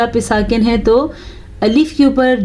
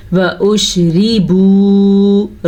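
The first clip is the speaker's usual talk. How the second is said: slow melodic tajweed recitation; Quran recitation; much slower than usual. Shown as a demonstration. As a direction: draw the vowels out like a Quran reciter. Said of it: "teacher recites the word to teach".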